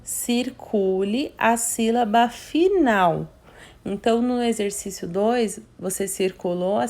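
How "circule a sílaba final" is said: reading the instruction slowly and clearly; teacher reads the exercise instruction slowly; slow reading aloud. Shown as a demonstration. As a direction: read the task line out slowly for the kids. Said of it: "fala assim".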